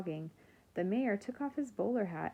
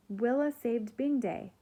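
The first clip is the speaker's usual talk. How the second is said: higher, louder announcement; loud proclamation; 5 semitones higher, 7 dB louder than usual. A narrator's reading. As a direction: proclaim it to the whole crowd. Nori announces it to everyone.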